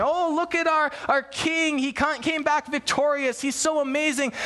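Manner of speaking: high-pitched gushing, mock admiration; adoring crowd. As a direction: gush like onlookers hailing their returning king. Crowd hailing a victorious king.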